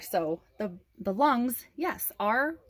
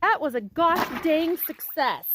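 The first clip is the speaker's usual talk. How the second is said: triumphant; 7 semitones higher, 4 dB louder, slower than usual. Real, not acted. Triumphant reveal after the work.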